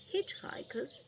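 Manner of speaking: ordinary delivery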